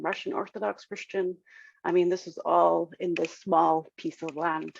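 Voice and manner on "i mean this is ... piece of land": ordinary delivery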